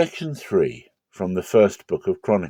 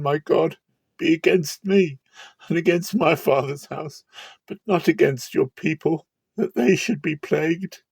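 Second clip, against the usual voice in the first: higher, urgent pleading that keeps climbing; desperate plea; 8 semitones higher than usual. Acted. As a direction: beg God to spare his people, rising as you go. David begs God to spare people.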